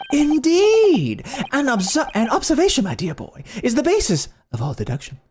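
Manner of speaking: put-on the detective instructing his dear boy; pompous detective. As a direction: boast like a brassy great detective. Voices Sholmes boasting.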